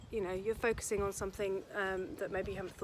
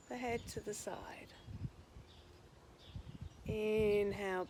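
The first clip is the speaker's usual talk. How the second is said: guided relaxation; 5 dB quieter than usual. Acted. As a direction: stretch the words out to pace their breathing. Leads guided breathing and stretches.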